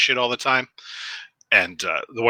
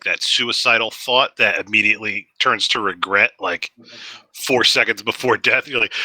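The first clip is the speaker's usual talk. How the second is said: ordinary delivery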